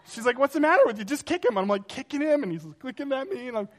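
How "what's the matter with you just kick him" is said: put-on higher, louder, impatient girl's voice; mock the girl yelling advice. Fellow rider scolds him from the side, high and loud. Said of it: "this one girl's like".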